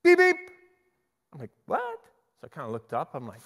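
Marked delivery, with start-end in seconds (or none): 0.0-0.4 high, loud horn beeps; car horn imitation. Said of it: "It's like a car horn". 1.7-2.0 high-pitched puzzled yelp; startled. Acted. Startled by horn in buffet.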